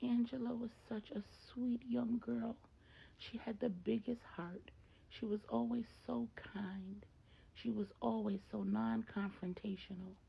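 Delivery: a mother fondly mourning through tears, lightly voiced; tearful. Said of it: "Maria cries and says".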